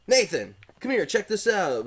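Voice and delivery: mild impression of an excited friend; buddy's voice. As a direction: lightly imitate a buddy calling him over. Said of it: "in his, like, voice".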